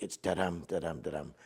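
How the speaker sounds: da-DUM da-DUM with the stress on dum; iambic beat. Shown as a demonstration. Poet to host, marking the meter: soft rhythmic da-DUM. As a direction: tap out the iambic beat softly. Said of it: "it's an iambic".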